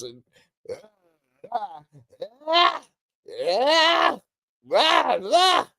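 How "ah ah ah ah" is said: high sharp karate yelps; karate grunts. Retold or imitated. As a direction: bark out karate yells. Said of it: "he be doing this".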